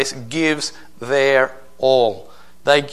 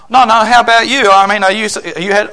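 rising, louder, insistent questions to the clerk; mock pastor witnessing; 6 semitones higher, 5 dB louder, faster than usual. Retold pastor pushing a store clerk on salvation, insistent and rising. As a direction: press the clerk about being saved, climbing higher.

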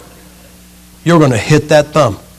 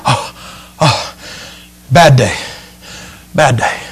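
low, groaning little acorn; mock misery with spent sighs; winded, upset acorn; 4 semitones lower, slower than usual. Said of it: "He's kind of winded. He's looking a little upset".

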